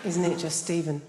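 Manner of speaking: ordinary talk, nothing marked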